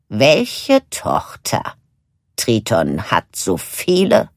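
slow, puzzled witch voice; a lightly voiced wondering sister; curious witch sister. A narrator's reading. Lucinda asks. (nachdenkliche Hexenschwester)

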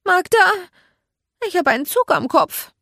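high, loud, urgent call; calling for help. Acted. Narrator with toy train in hair, alarmed call.

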